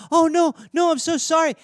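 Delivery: high, loud sorry pleading; apologetic owner. Retold or imitated. Owner apologizing to hurt cat, high apologetic coo. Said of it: "do the mea culpa".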